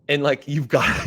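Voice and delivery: normal talking voice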